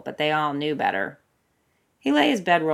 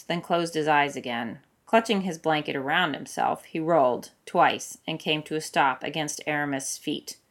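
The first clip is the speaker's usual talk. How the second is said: plain reading voice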